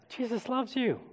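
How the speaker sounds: high, soft and small; hushed delivery. Shown as a demonstration. Preacher varying the message for church, hushed and high. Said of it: "what if we change the message".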